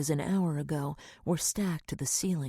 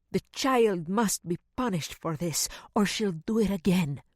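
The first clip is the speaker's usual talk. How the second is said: put-on accented, louder scolding housekeeper; stern housekeeper; 2 semitones higher, 3 dB louder than usual. A narrator's reading. Housekeeper telling the mother to punish the child, stern. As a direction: lay down the law firmly.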